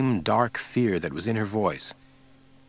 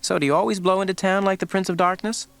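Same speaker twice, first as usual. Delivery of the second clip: needling; 10 semitones higher than usual. A narrator's reading. Scully needling Mulder.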